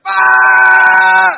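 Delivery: put-on sudden high, loud howl of a hurt child; child's wail. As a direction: let out the child's full-throated wail. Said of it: "And then it's like".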